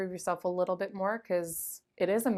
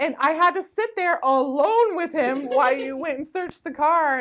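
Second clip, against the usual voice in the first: loud, high mock outrage; hammed-up aggrieved scolding; mad mom; 9 semitones higher, 10 dB louder than usual. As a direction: imitate a mother's indignant complaint at full volume. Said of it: "mad at me. She's like".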